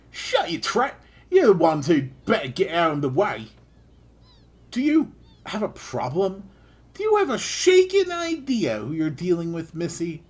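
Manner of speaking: high, loud, cocky Australian taunt; bad Australian, picking a fight; hammed-up Australian girl mouthing off; terrible Australian accent. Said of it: "the terrible Australian".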